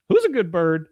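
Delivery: imitated bird owner baby-talk; coaxing a bird. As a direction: coo at a pet bird, high and loud. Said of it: "and she goes".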